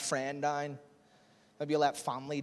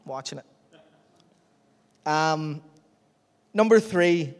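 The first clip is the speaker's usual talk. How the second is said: usual speaking voice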